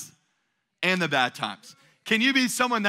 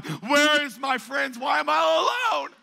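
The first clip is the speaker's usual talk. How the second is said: high, whiny mock wail of a lonely person; 7 semitones higher, slower than usual. Pastor mocking a lonely person eating ice cream, whiny wail. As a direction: ham up a self-pitying cry at home.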